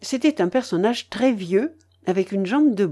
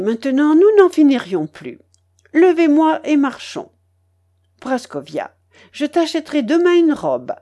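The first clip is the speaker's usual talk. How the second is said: higher, louder bossy matriarch; imperious, generous grandmother; 4 semitones higher, 5 dB louder than usual. Acted. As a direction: dispense gifts and orders with emphatic bursts. (babouchka autoritaire et généreuse)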